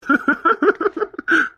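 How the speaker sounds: bright high laugh; husband laughing while teasing his wife. Acted.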